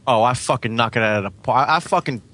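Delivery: ordinary talk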